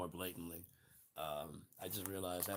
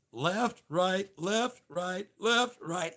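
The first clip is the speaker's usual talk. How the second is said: high chanted left right; drill march call; 9 semitones higher, 5 dB louder, much slower than usual. Pretend. Marching orders called out, high drill chant. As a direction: call cadence like a drill sergeant.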